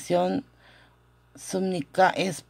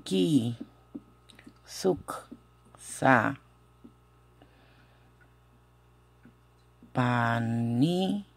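slow, syllable by syllable with long pauses; pronunciación por sílabas; 5 semitones lower, 3 dB quieter, much slower than usual. Shown as a demonstration. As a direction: sound it out slowly for learners, piece by piece. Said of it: "¿cómo, eh, pronunciamos esto?". (sílaba por sílaba, con pausas largas)

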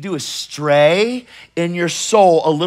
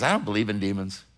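a quiet flat denial; skeptical psychologist; 8 semitones lower, 8 dB quieter than usual. Retold or imitated. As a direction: lower and softer, dismissive. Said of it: "He goes".

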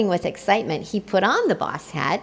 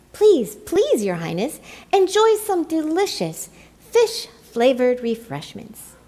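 fawning cry; 6 semitones higher than usual. A narrator's reading. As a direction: beg him eagerly to sit. Penguins urge the emperor.

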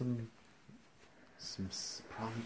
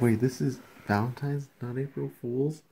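excited joking; 4 semitones higher, 11 dB louder than usual. Real, not acted. Joking disbelief, much louder.

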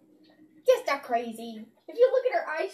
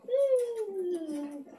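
ordinary talk, nothing marked

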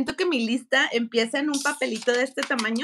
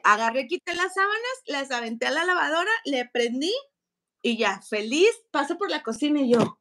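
ordinary talk

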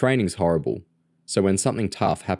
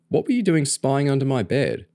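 pitched-up indignant demand; 8 semitones higher than usual. A narrator's reading. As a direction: demand it, affronted, voice raised in pitch. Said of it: "James asked indignantly".

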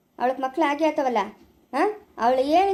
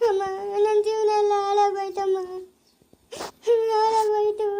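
higher, wailing through tears; crying wail; 4 semitones higher than usual. Acted. Geetha crying to her mother-in-law that her life is ruined, wailing. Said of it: "ಯಾಕೆ ಅಳ್ತಾ ಇದೀಯಾ?".

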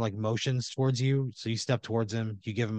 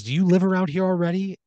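high, louder questioning voice; probing question; 8 semitones higher, 6 dB louder than usual. Acted. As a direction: ask it pointedly, pitch and volume up. Neighbour character probes the new owner.

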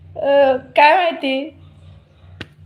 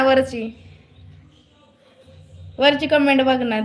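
ordinary delivery

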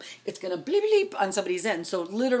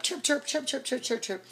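imitated phone chirps; 8 semitones higher, 3 dB quieter than usual. Phone notifications going off.

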